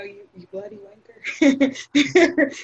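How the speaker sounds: slow mock British curse; playful fake British insult; British accent. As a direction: drag it out in a put-on British accent. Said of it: "British curse words".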